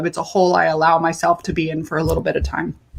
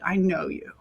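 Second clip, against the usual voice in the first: hushed repeat; 8 dB quieter than usual. Real, not acted. Quiet repeat of friend's words.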